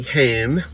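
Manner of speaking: "home" with a South Jersey vowel, sounding like "haim". Shown as a demonstration. Vlogger showing how South Jersey says "home", as "haim". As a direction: say "home" as "haim" with a rising end. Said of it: "as we say in South Jersey".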